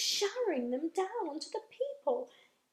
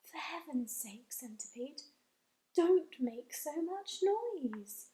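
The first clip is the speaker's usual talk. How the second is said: softly; 6 dB quieter than usual. Acted. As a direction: hush him gently. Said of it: "James said softly".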